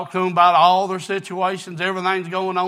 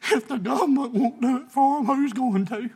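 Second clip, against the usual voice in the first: higher, pleading pulpit voice; preaching; 7 semitones higher, 4 dB louder than usual. Acted. Preacher asking his church who will love them, pleading. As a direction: press the question on them, rising.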